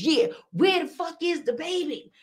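high hyped crowd guy; crowd member. Retold or imitated. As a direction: echo it like an excited fan. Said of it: "Somebody in the crowd says".

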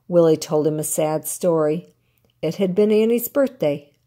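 ordinary delivery, nothing marked

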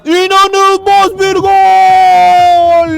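slow, stretched, loud goal yell; screaming football commentator. Real, not acted. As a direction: hold the scream on every word. Goal call in live commentary. (bağırarak gol anonsu)

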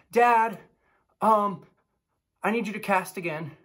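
put-on a child nagging his father; kid calling dad. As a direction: mimic a kid pestering dad. Kid voiced whining at dad.

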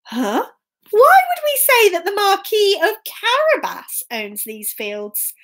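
high-starting, baffled question; puzzled workers. Acted. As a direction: query the cat with mild disbelief.

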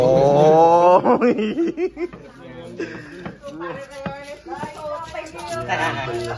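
extended high-pitched laughter; long high laugh. Real, not acted. Laughing at people scrambling for food.

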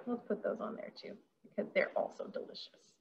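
soft, voiceless whisper to herself; whispered aside. Real, not acted. Adding olives to the board, a quiet whispered aside. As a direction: whisper it as a little secret.

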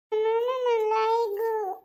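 high, drawn-out whine of hurt; child's wail. Acted. Fallen child to family after tripping, high hurt wail.

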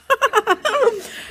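high burst of real laughter; laughing at own joke. Real, not acted. Preacher laughing at his own joke to the congregation, real laugh.

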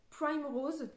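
Prime Rose tried in an English accent; reading the name Prime Rose off the cover in English; English accent try. Shown as a demonstration. Vlogger checks the bookshop name, English accent. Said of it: "je vais vous le retrouver".

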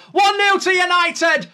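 loud, high celebrating yell; goal celebration. Real, not acted. Fan screaming at the goal on stream, loud and high. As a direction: roar the goal out.

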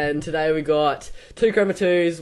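ordinary talk

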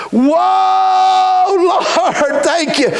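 high ringing cry of thanks with a laugh; joyful whoa. Real, not acted. Preacher thanking the Lord, joyful cry. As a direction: burst out praising.

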